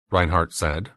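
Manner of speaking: usual speaking voice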